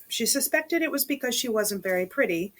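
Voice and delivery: usual speaking voice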